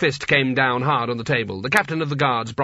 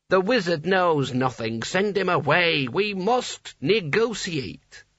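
talking hat; 5 semitones higher, slower than usual. A narrator's reading. Hat speaks, slow.

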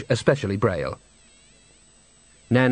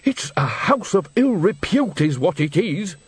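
slow, higher exasperated outburst; 6 semitones higher, slower than usual. A narrator's reading. Nanny losing patience with Granny, mild exasperated outburst. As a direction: blurt it out, patience gone. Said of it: "Nanny's patience gave out".

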